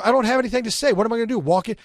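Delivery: high, loud retold protest; arguing with himself. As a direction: argue back at yourself, voice shooting up. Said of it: "and I would argue".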